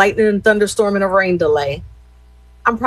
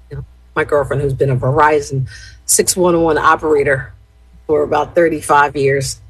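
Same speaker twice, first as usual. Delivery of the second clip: ordinary talk, nothing marked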